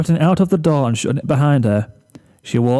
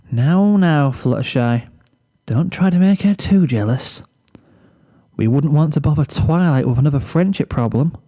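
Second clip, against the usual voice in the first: playful Fluttershy; 3 semitones higher than usual. Acted. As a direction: gently tease yourself, half giggling. Fluttershy teases herself giggling.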